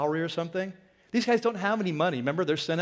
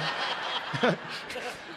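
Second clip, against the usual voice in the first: 1 breathy laugh; 3 semitones higher, 6 dB quieter than usual. Real, not acted. Laughs at free pie story.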